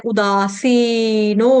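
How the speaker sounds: उदासीनो slowly with long दा, सी and नो; slow long vowels. Shown as a demonstration. Teacher modelling long vowels for the class. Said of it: "सी दीर्घ है".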